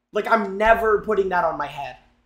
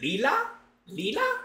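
unsure query; 9 semitones higher, 5 dB quieter than usual. Real, not acted. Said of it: "I'm looking it up".

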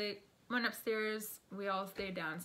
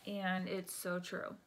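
ordinary delivery

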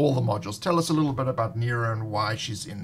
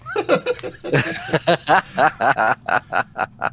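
long hearty laugh; 3 semitones higher, 4 dB louder than usual. Real, not acted. Laughing at the name joke.

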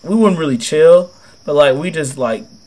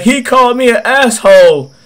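complaining customer; 9 semitones higher, 7 dB louder than usual. Retold or imitated. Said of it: "And he's like".

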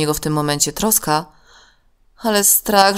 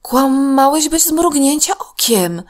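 put-on much higher, slower astonished girl's voice; surprised; 10 semitones higher, 5 dB louder, slower than usual. A narrator's reading. Tosia to her friend who just lied, slow astonished. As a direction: gasp it out in disbelief. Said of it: "powiedziała zaskoczona Tosia".

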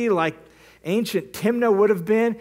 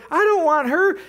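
higher, louder, indignant snap; angry refusal; 10 semitones higher, 4 dB louder than usual. Retold or imitated. Samson rejects the father-in-law's offer, indignant. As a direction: toss it back in his face, offended.

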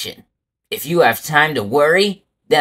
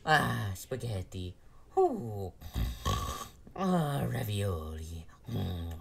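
whispering; 7 semitones lower, 15 dB quieter than usual. Acted. Savoring food, hushed.

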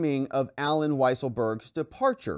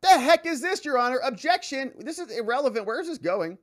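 mock objecting lawyer; 10 semitones higher than usual. Trump's lawyer objects to the judge, high indignant voice.

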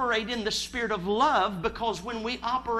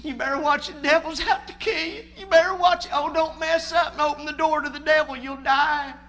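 high, loud mock warning voice, building; fearmongering Pentecostal preacher; 5 semitones higher, 5 dB louder than usual. Preacher scaring the congregation about the devil, mock dire warning. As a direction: ham up the old fire-and-brimstone scare. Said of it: "traditional Pentecost that injected you with fear".

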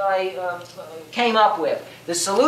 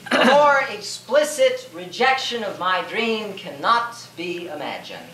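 ordinary talk

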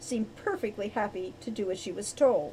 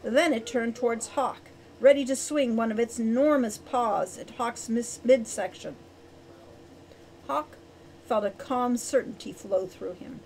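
ordinary delivery

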